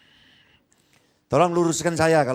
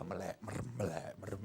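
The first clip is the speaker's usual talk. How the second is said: "melek merem" barely murmured, much softer and lower, as if eyes half shut; dazed mumble; 9 semitones lower, 19 dB quieter than usual. Shown as a demonstration. Preacher mimics an entranced pastor, murmuring "melek merem" very softly and low.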